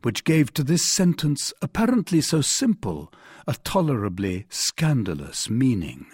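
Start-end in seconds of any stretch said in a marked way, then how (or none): none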